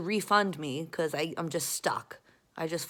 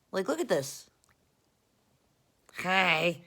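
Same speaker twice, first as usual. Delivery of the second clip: ordinary delivery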